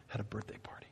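whispered softly. Real, not acted. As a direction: let it fall to a breathless whisper. Emotional climax of story.

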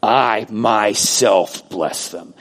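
very slow, weighty "I myself bless them"; emphatic. Acted. Preacher voices God blessing in person, "I myself" emphatic. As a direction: draw out "I" and "myself" to make the point. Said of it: "going out of his way and saying".

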